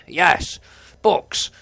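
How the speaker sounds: high, loud burst of delight; excited cheer. Pretend. Player thrilled by a library full of books, excited cheer. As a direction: whoop it out, thrilled.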